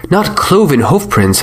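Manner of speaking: "cloven" tossed up high, mocking; mock his "cloven hoofprints" back at him; sarcastic echo. Professor mocking the sheikh's ghoul tale, sarcastic. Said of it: "my sarcasm".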